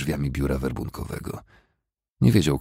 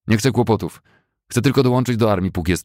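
frightened plea; 6 semitones higher, 6 dB louder than usual. Acted. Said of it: "najwyraźniej wystraszony".

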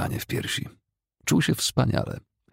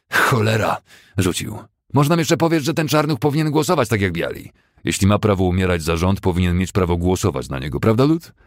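loud, much higher indignant rant; exasperated rant; 4 semitones higher, 5 dB louder than usual. Acted. Fessenden outraged at the voting idea, loud rant. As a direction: rant in disbelief.

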